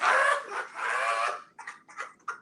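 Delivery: shrieking laugh. Real, not acted. Laughing hard at caller.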